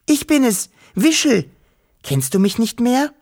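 very high-pitched small creature voice; little Wischel calling. A narrator's reading. Wischel showing herself to the human girl, high calling. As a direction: pipe up high, eager to be recognised.

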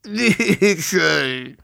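high, long plaintive call; grieving call, stretched out; sorrowful whimper. A narrator's reading. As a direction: wail the name mournfully. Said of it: "wimmerte der Drache kummervoll".

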